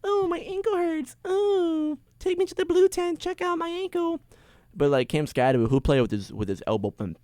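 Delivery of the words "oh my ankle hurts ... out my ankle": high falsetto, slow mock whine; whiny injured player. Mocking Nabers faking an ankle injury, high whiny falsetto. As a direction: whimper like a pampered star. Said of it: "he's just gonna".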